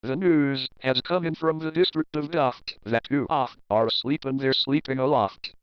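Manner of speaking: ordinary delivery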